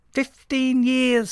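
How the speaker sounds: very high, slow, loud squawk. A narrator's reading. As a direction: stretch it out in an outraged squawk. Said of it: "squawked Mister Merrill".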